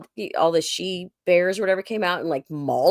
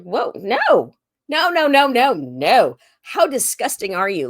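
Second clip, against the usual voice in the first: loud, high no no no pushing him off; disgusted shout; 4 semitones higher, 6 dB louder, slower than usual. Retold or imitated. To a crude guy at a show, a loud disgusted refusal. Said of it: "I was like".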